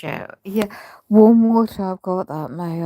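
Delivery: ordinary talk, nothing marked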